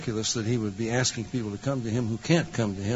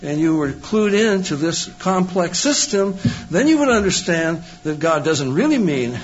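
higher, louder mock-reasonable tone; sarcastic mockery; 6 semitones higher, 5 dB louder than usual. Debater mocking the Calvinist view of God, sarcastically. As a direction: ridicule the opponent's view with a sneer in the voice.

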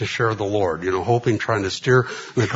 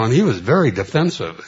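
usual speaking voice

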